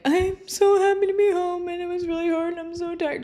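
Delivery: high, tearful whine of exhaustion; teary retelling. Retold or imitated. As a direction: replay the sobbing phone call, half laughing. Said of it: "in tears".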